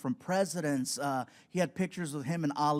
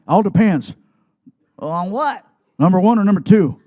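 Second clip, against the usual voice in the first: gruff shout; 14 dB louder than usual. Retold or imitated. Stone Cold quizzing.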